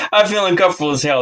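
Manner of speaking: laughing nervously through it; uneasy laugh, then rushed words. Real, not acted. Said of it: "That's why I'm laughing nervously".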